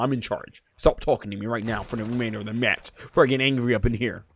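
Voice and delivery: low, very soft warning; quiet threat. Real, not acted. Ordering a teammate to stop talking, quiet threat. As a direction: drop right down and lay down the law.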